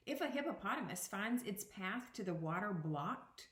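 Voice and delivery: put-on expressive read-aloud to children; picture book read to students; reading aloud to a class. Teacher reads picture book aloud.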